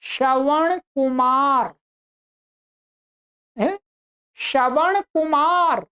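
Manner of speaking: श्रवण कुमार said slowly with retroflex ण; slow correct pronunciation. Shown as a demonstration. Teacher slowly modelling the right way to say his name. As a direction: draw out श्रवण कुमार, curling the tongue for ण. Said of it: "तो मेरा सही नाम क्या बोला जाएगा?".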